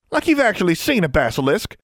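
high-pitched, snippy sisterly jab; snappish retort. A narrator's reading. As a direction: snap back at a bickering twin. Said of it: "Leah snapped".

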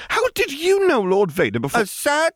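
put-on high, eager little boy; toddler voice. A narrator's reading. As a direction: press like a curious small child. Ben questions.

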